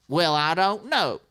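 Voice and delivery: heckler. Retold or imitated. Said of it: "And he goes".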